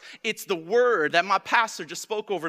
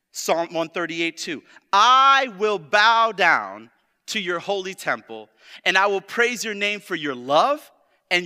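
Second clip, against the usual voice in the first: loud, slow, emphatic verse reading; preaching scripture; 8 dB louder than usual. Real, not acted. Preacher reads Psalms to the congregation, loud preaching. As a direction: proclaim each verse, stretching the key words.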